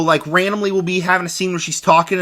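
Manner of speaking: ordinary talk, nothing marked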